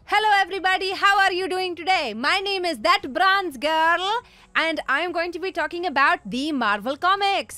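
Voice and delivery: mock cute presenter voice. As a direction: play up a sweet accented host introducing herself. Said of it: "I could go out there and be like".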